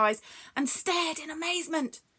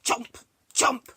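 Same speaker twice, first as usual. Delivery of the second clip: loud, higher mock chomping; greedy creature munching noise; munching sounds; 3 semitones higher, 6 dB louder than usual. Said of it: "she went".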